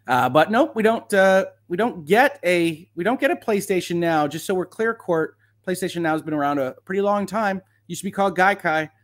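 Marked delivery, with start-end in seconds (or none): none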